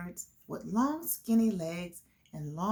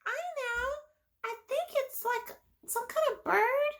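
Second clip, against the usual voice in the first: much higher, small puppet voice; puppet child voice; faster than usual. Acted. Puppet guessing the bird for the class, high child voice. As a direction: play a tiny kid puppet guessing.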